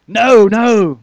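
loud, high frantic no; panicked denial. Acted. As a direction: push him away in fear. Peter's curse and denial.

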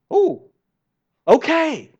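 impressed surprise. Retold or imitated. Gideon reacting to the sign.

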